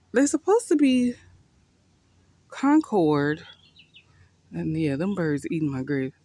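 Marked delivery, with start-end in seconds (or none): 0.1-3.5 loud, high exasperated cry; exasperated outcry. Real, not acted. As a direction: cry it out in dismay. Upset that birds ate grapes.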